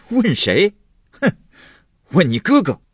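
loud, high, mocking echo with a huff; scornful retort. Husband naming his wife's brother as culprit, scornfully indignant. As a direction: throw the question back angrily. Angry husband echoes her question.

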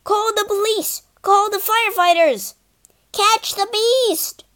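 high, urgent angry orders; furious ministers shouting orders about the escaped dragon. Acted. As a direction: raise the alarm in a panic. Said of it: "they were furious".

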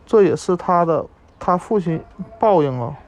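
ordinary delivery

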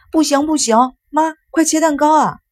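put-on high, whiny child voice; high-pitched childish protest to mum; protesting little boy. A narrator's reading. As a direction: plead urgently like a small boy.

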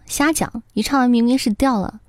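normal talking voice

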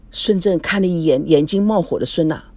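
usual speaking voice

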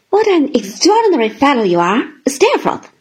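loud, fast astonished exclamation; high, loud cry of surprise on Steerforth; exclaiming. A narrator's reading. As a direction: burst out in amazement. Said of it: "I exclaimed".

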